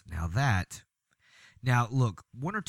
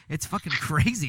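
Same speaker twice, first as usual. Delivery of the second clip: ordinary talk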